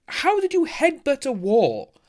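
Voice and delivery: incredulous high slow question; high, stretched disbelief; amazed. Real, not acted. Reading chat, amazed.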